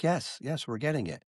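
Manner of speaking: acted waiter's quiet brush-off; placating waiter. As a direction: placate a pestering customer under your breath. Acts the waiter answering the pest.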